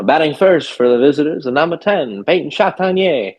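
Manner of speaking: put-on old-timey announcer. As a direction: call the lineup like a vintage stadium announcer. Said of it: "in my old-timey accent".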